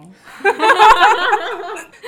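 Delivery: loud high laugh. Real, not acted. Laughs at her own remark.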